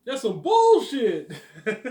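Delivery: imitating Brad, squeaky and slow; Brad impression. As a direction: drag it out through a laugh. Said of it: "I know all his words".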